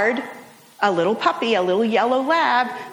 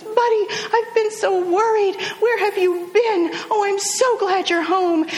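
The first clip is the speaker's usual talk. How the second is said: much higher, gushing voice hugging the puppy; relieved owner; 9 semitones higher than usual. Retold or imitated. Owner hugs her returned puppy, high gushing relieved voice. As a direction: pour out relief over the returned dog. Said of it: "She goes".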